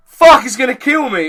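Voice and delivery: high, loud frightened cry; panicked yell. Pretend. You panic at a spider, loud high yell. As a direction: yelp it in terror. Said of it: "you'll either go".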